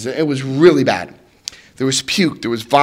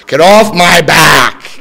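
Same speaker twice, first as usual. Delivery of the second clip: slow, loud get off my back; loud slow demand; 3 semitones higher, 7 dB louder, much slower than usual. Shown as a demonstration. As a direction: stretch off and push it out loud.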